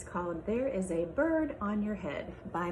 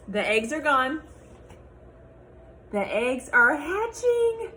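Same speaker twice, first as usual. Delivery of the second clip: lively picture-book read-aloud with character voices; reading to children; 4 semitones higher than usual. Acted. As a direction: read it to a class of little kids.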